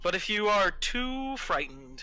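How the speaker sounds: patronizing villain coaxing. Acted. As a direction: coax the frightened one, then condescend. Villain coaxes fleeing Drea.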